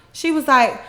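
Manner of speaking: ordinary talk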